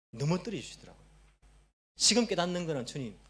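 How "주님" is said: soft admission of helplessness to God; humbled prayer. Retold or imitated. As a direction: let it sink to a hushed confession. Voices own prayer of helplessness.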